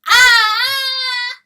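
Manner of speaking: long, high, loud scream; anguished scream. Acted. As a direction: scream as if burned by the words. Rooster struck by the father's words.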